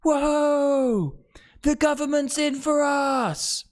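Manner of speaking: very high, sing-song mock cheer. The public cheering the price cap in a squeaky mock cheer. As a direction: cheer like a gullible crowd, squeaky and slow at the end. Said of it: "Everyone's like".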